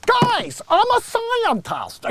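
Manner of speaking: very high, slow nerdy voice; mock Bill Gates. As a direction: pompously claim to be a scientist. Mocking Gates saying scientist.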